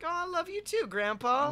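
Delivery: high, sweet, touched; tender surprised reply. Retold or imitated. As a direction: melt a little as you answer. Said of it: "I'm like".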